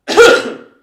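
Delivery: a loud acted cough; loud demo cough. Shown as a demonstration. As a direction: cough sharply to show the word. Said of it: "is to cough".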